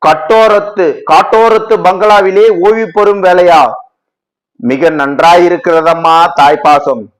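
high, loud, furious tirade at the goddess; roaring accusation. Acted. Devotee roars in anger at Kali who refuses mercy, loud and high. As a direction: roar your bitter accusation at Kali. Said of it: "கர்ஜித்தான்".

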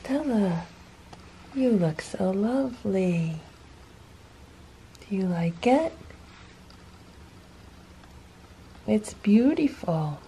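soft praise. Real, not acted. Softly praising the cat.

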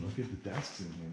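ordinary talk, nothing marked

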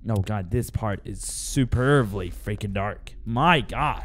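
low, hushed dread; uneasy hush. Real, not acted. Player facing a freaking dark part, uneasy hush. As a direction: drop right down, soft and low.

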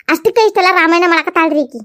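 usual speaking voice

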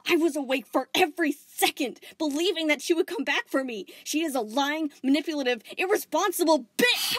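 high, loud, furious outburst; bitter fury. Acted. Spinel raging at Volleyball about Pink, furious. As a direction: spit out every insult.

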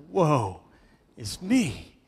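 restrained cry of awe, in separate phrases; preacher's solemn quoted lament. Acted. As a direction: cry out in measured, dramatic pieces. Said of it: "cries out".

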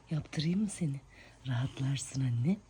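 normal talking voice

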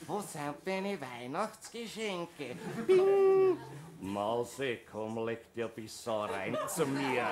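put-on low, soft, slow old residents; old man beckoning the nurse; confused old voices. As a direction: mumble like demented residents. Demented residents' odd requests.

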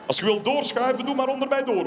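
high friendly shout to the queue; cheery invitation. Acted. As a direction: wave people through cheerfully. Inviting people under his legs.

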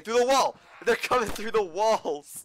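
loud rising alarm; alarmed shout. Real, not acted. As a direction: shout the warning in panic. Zombie through wall.

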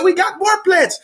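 high, eager host voice; lightly voiced generous host; imitating the restaurant guy. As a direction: pitch up and push the food on him.

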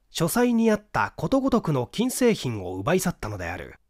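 ordinary delivery, nothing marked

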